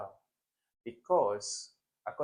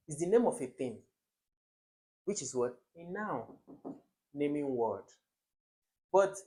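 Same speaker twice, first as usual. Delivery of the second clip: usual speaking voice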